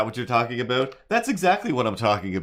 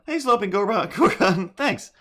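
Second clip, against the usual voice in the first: high squeaky greeting; 9 semitones higher, 4 dB louder than usual. Pretend. Greeting follower, laughing.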